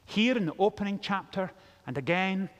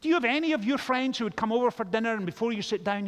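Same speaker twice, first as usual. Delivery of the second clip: ordinary talk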